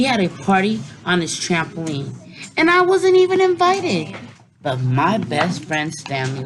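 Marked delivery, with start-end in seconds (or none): 2.6-4.1 much higher and louder, outraged; high indignant outburst. A narrator's reading. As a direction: squeal the injustice of being left out. Indignant child narrator left out.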